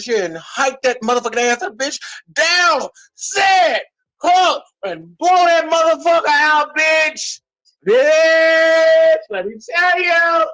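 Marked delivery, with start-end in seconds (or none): 2.3-7.4 mock football snap count. Coaching her girls on the move, a mock quarterback snap count. 7.9-10.5 high, loud and slow; excited shout. Real, not acted. Hyping up her listeners, a high slow excited shout.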